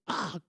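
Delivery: high mock wail; exaggerated crying wail. Son overdoing his crying, imitated high wail. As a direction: fake an overdone child's sob. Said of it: "오버하면서 우는 거예요".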